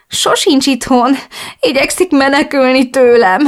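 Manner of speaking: slow, high tearful sobbing; words breaking through tears. Acted. Chris crying to her friend about her husband, slow and tearful. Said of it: "bőgtem tovább".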